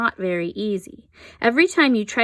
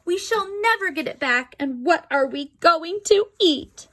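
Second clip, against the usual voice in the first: high, worried wife voice; fretting Missus Gregg; 9 semitones higher than usual. A narrator's reading. Wife worrying that they've lost their house. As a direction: wail it high and anxious. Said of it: "said Missus Gregg".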